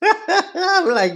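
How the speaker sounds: laughing through words. Real, not acted. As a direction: chuckle while saying it. Laugh on line.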